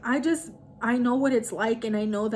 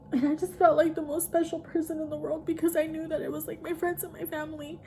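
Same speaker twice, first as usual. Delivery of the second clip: tearful thanks; 5 semitones higher, 3 dB quieter than usual. Real, not acted. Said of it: "I don't know why I'm ugly crying right now".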